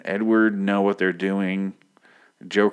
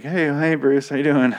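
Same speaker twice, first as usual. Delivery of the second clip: cheery greeting; 7 semitones higher, 4 dB louder than usual. Retold or imitated. Alfred greeting Bruce cheerfully.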